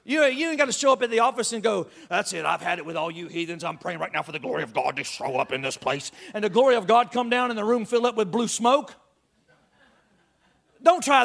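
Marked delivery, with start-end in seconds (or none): 2.1-6.1 lower, put-on pious scolding voice; hammed-up self-righteous declaration; mock holy-roller. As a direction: play a smug churchgoer condemning the heathens. Mocking a self-righteous office prayer.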